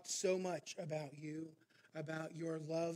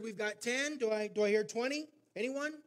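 loud and higher, asking the room for twenty; auctioneer calling out; 5 semitones higher, 9 dB louder than usual. Retold or imitated. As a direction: push the crowd for a bid. Auctioneer pushing for a higher bid.

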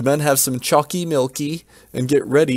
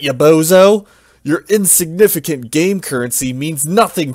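loud, high, scornful knight; gruff knight voice; 4 semitones higher, 6 dB louder than usual. Acted. Old knight mocks the heroes' game money, loudly. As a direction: scoff at the puny offer. Dark Knight scoffs at the offer.

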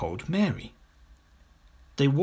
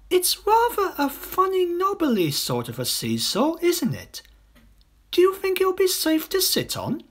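put-on high, questioning girl's voice; a cautious older girl; doubtful older girl; 3 dB louder than usual. A narrator's reading.

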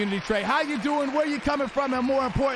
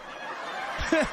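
brief chuckle, rising higher; a quick, soft laugh; short laugh; 5 semitones higher, 4 dB quieter than usual. Real, not acted. Laughs on stream with chat.

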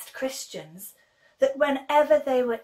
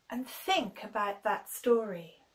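plain reading voice

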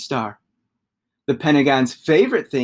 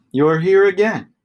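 higher, louder, a mildly exasperated shapeshifter; weary shapeshifter; 5 semitones higher, 6 dB louder than usual. Acted. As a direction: greet the returning shape with a light sigh. Shapeshifter greets again.

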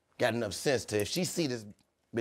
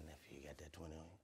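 quiet; 4 semitones lower, 22 dB quieter, faster than usual. Retold or imitated. Unc voiced asking for money.

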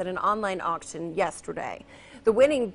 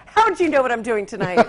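laughing; 7 dB louder, faster than usual. Real, not acted. Teasing cohost, laughing.

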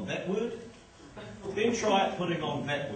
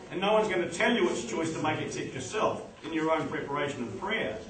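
ordinary talk, nothing marked

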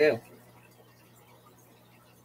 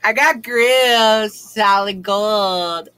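slow boast; much slower than usual. Real, not acted.

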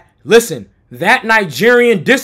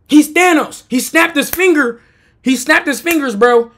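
ordinary delivery

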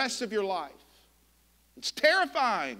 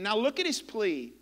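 normal talking voice

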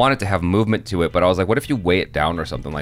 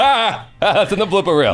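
high, put-on laugh into the words; hammed-up giggly actor; fake-laugh as if caught in a blooper; mock blooper laughter; 10 semitones higher, 3 dB louder than usual. Mocking actors who play up to bloopers.

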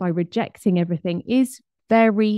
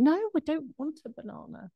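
higher and much softer, a mock whine; whiny refusal; 7 semitones higher, 9 dB quieter, faster than usual. Wife refusing the banana, lightly hammed-up whine. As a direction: whimper no like a sulky child.

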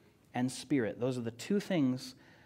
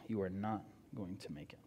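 "you are not going to make it" repeated much lower and softer, stress on "you"; low, grave warning; 6 semitones lower, 11 dB quieter than usual. Shown as a demonstration. Preacher warns the congregation directly, low and quiet. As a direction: drop the voice and warn them gravely. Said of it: "I will tell you right now".

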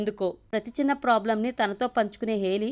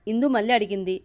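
ordinary delivery, nothing marked

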